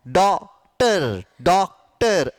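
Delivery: डॉक्टर split as "डॉक-टर", open ऑ; drawn-out डॉक्टर, vowel between आ and ओ. Shown as a demonstration. Teacher models डॉक्टर for students, open ऑ. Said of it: "का उच्चारण".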